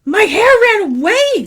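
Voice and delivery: put-on high, plaintive kid voice; young boy Aaron. Boy explaining his baldness to mother, high kid voice. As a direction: blurt it out high like a child explaining. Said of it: "said Aaron".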